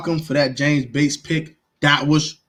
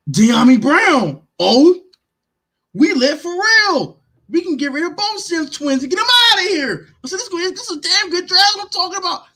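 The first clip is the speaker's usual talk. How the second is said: hyped; 13 semitones higher than usual. Retold or imitated. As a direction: shout it like a fan celebrating. Retold hyped reaction to draft pick.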